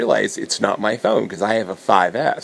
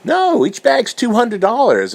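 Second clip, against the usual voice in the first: much higher, louder, slower mock voice of the guy; mock pushy seller; 5 dB louder, slower than usual. The printer guy quoting his price in a high mocking voice. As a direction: ham up the smug seller. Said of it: "And he's like".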